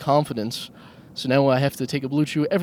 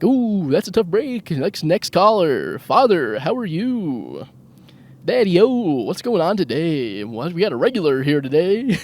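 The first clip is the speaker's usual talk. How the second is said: loud, high, slow hammed-up DJ; radio host voice; 5 semitones higher, 5 dB louder than usual. Moving on from mom to dad on air, hammed-up DJ. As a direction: brush mom off and greet the next caller like a cheesy host. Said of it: "And I'm like".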